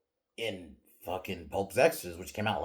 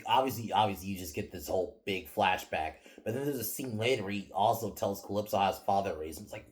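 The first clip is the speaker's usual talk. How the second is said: ordinary talk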